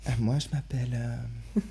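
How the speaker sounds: soft mock feminine voice; put-on woman's voice. Man playing a woman searching for her name, soft mock feminine voice. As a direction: hesitate in a pretend woman's voice. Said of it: "Pourquoi tu parles comme ça, mec?".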